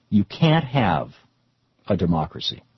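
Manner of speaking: ordinary talk, nothing marked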